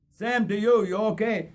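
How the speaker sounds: a friendly mate calling back from the train steps; Morris's parting reply. Acted.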